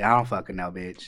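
normal talking voice

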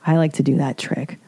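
usual speaking voice

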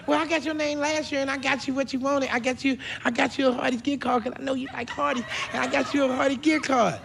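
put-on high-pitched chattering child; little niece. Little niece to uncle about last year's gift, childlike. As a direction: explain it eagerly in a small child's voice. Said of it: "little niece looked at me and said".

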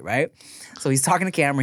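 usual speaking voice

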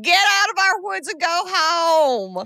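high, drawn-out Southern sheriff holler; thick Southern accent. Retold or imitated. Sheriff orders people out of the woods, hammed-up Southern drawl. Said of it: "Southern, more Southern".

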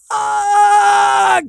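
a sudden, very high yelled curse; umpire swearing loud. Retold or imitated. Umpire hit by the pitch yells a curse, high and loud. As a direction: blurt it out like a ball just hit you. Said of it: "the umpire yells that F word".

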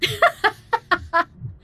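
wild, high cackle; maniacal laugh. Acted. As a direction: cackle like a madman. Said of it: "I let out a wild, maniacal laugh".

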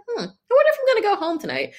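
high, quick, breezy text voice; mock casual. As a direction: sound carelessly unbothered. Reading her own casual texts.

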